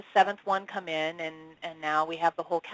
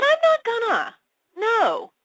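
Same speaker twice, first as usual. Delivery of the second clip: mock petulant refusal; 6 dB louder, slower than usual. Retold refusal to channel guide.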